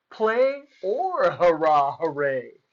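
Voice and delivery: plain reading voice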